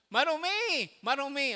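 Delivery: high call of Marumi, falling; mock leper's cry; acted cry of unclean. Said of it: "laging isisigaw ng".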